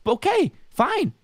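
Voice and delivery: high, slow sarcastic "go off queen"; sassy mock approval. Sarcastic mock encouragement to rival.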